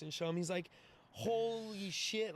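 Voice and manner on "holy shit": high, drawn-out imitated amazement; amazed reaction. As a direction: stretch out the first word in mock awe. Buddy amazed by the kick.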